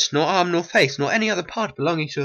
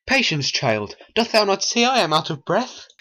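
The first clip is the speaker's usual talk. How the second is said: ordinary delivery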